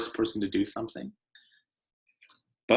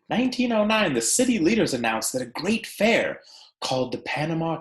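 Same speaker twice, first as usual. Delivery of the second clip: bright storybook read-aloud, pitched up and unhurried; animated picture-book narration; reading to children. Acted. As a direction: read it to a class of kids, lively and clear. Teacher reads picture book aloud.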